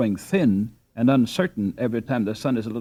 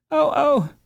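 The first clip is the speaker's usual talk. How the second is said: sharp high cries of pain, louder; a little girl crying out in pain; 6 dB louder than usual. A narrator's reading. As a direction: yelp as it suddenly hurts. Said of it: "she cried in real pain".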